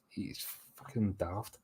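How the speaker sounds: slow, quiet disgusted mutter; muttered aside. Real, not acted. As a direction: mumble it under your breath.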